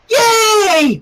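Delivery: high, loud cheer; a squeal of delight; bright excited yay. A narrator's reading. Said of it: "Pinky cheered".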